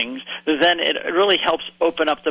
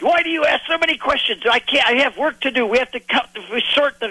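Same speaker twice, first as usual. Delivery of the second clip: higher, imitated thick Hebrew accent; 10 semitones higher than usual. Retelling a busy Israeli fisherman's brush-off, thick Hebrew accent. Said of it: "in very thick Hebrew accent".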